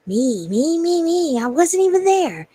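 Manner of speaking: loud, high singsong self-mockery; self-mocking. Real, not acted. Mocks own repeated slip.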